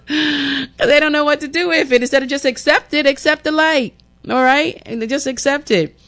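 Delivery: amused, high squeal of disbelief; worked-up high-pitched exhortation; high-pitched exclaiming. Real, not acted. Exasperated, amused plea after laughing.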